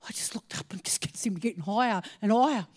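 ordinary talk, nothing marked

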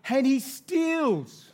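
high, loud indignant cry; hammed-up outrage. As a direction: play up the outrage, stretching the final words. Replaying his outraged thought.

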